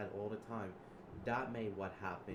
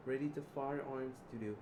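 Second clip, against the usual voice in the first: usual speaking voice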